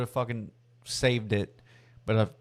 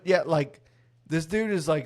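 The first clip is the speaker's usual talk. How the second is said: normal talking voice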